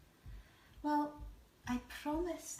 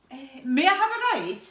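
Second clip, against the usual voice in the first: gorilla voice; 4 semitones higher, 12 dB louder than usual. Acted. Gorilla character asks.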